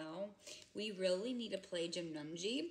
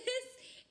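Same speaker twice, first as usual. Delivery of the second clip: high laugh. Real, not acted. Laughing while telling the story.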